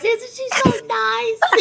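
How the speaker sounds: high, slow, sugary sweet voice; imitating Brittany's sweet voice. Host mimicking her guest's sweet way of talking. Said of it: "See how she talks like this?".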